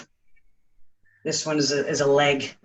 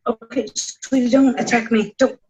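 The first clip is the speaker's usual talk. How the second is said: sweet pet talk; 6 semitones higher than usual. Real, not acted. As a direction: coax the cat gently. Pleading with the cat.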